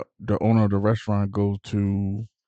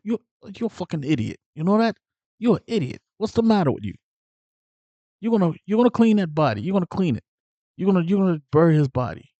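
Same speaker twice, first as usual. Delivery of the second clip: higher-pitched imitated anger; mad, scolding; 7 semitones higher, faster than usual. Jimmy mad at Tommy over the body, high imitated scolding. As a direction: chew him out in a raised, worked-up voice. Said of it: "Now Jimmy gets mad".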